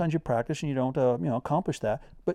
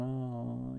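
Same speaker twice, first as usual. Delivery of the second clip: weary groan; 10 dB quieter than usual. Retold or imitated. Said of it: "fried mentally".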